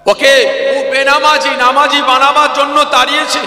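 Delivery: high, loud, relentless sermon shouting; fiery preaching. Acted. Preacher shouting at the congregation in a full-force sermon. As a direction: preach it at full force, hammering each sin.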